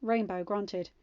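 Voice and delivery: usual speaking voice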